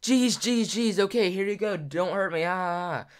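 high-pitched mock panic; hammed-up frightened kid giving in; afraid. Scared twelve-year-old handing over sunglasses, high panicky pleading. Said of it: "twelve-year-old little me was afraid of that".

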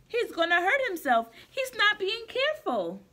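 worried sheep. Acted. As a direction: fuss like a nervous sheep.

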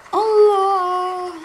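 loud, high drawn-out yell; yelling in shock at the game; shocked cry. Real, not acted. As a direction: cry out in surprise.

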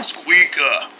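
low, deep answer; low growl. Pretend. Pretend gum ad, deep low echo. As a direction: drop it way down low.

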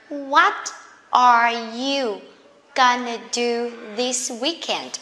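slow, word-by-word teacher's question; deliberate demonstration for learners; slow careful model. Shown as a demonstration. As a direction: separate each word with drawn-out care. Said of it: "learn how to speak this one quickly".

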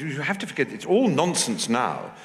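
high-pitched society lady, slowing down; Duchess of Devonshire. Retold or imitated. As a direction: play a duchess dismissing old nonsense. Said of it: "She said".

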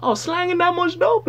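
high, far-off mock drug-dealer brag; mock rapper boast. Mocking rappers who think they're Pablo Escobar, high hammed-up boast. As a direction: ham up a rapper bragging about units.

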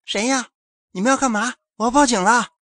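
high, loud shout through the door; frightened woman shouting through the door. A narrator's reading. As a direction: yell out at the intruders. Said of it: "大喊着".

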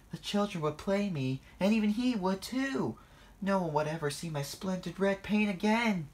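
high-pitched, mounting dismay; fretful panic. A narrator's reading. James imagining life as a funfair ride, fretting. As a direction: fret in a high voice.

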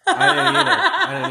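high laughing repeat. Real, not acted. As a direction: laugh through the words. Laughing reply to sister.

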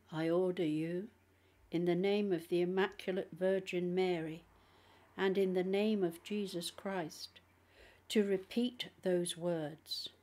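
usual speaking voice

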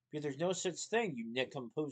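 plain reading voice

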